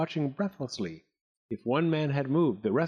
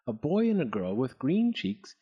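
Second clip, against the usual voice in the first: usual speaking voice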